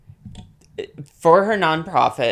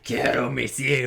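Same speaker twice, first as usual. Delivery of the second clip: put-on growling old man voice; 3 semitones lower than usual. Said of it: "her old man voice".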